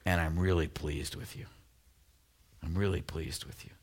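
soft, low, heartfelt praise; quiet, proud father's blessing; tender father. Acted. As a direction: affirm him softly like a proud dad. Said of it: "he goes on to say".

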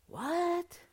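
high-pitched, disbelieving protest; incredulous. Acted. Preacher scolding Peter for rebuking Jesus, incredulous.

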